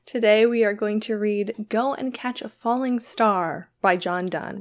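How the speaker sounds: usual speaking voice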